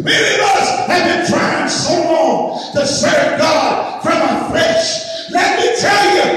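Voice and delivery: high, driving preacher voice; preaching at full force. Acted. Preacher stirring the congregation, preaching at full force.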